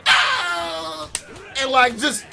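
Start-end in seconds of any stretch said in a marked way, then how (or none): 0.1-1.1 high loud cry; mock cry. Lady struck by bullets, high mock yell. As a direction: yelp as if hit. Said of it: "She was like".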